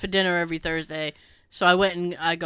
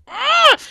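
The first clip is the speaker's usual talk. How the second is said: loud, high exasperated ugh; frustrated ugh; 7 dB louder than usual. Retold or imitated. Groaning at her friend's news.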